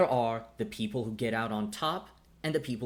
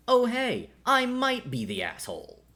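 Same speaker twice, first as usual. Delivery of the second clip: high, louder mock realization; mocking mimicry; 12 semitones higher, 5 dB louder than usual. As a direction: parody his sudden insight. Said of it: "to realize".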